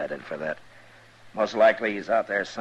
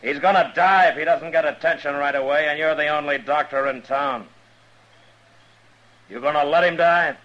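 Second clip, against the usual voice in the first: angry; 7 semitones higher, 6 dB louder than usual. Acted. Marshal confronting the doctor.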